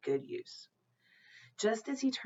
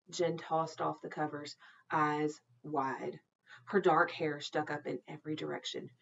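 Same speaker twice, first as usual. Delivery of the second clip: ordinary delivery, nothing marked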